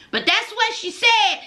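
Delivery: usual speaking voice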